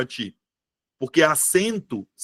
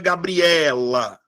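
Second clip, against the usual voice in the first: "Gabriela" slowly, with a long stressed E in "ela"; "Gabriela" drawn out, weight landing on "E"; much slower than usual. Shown as a demonstration. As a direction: stretch the E of "Gabriela". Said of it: "qual é a sílaba que eu falo mais forte?".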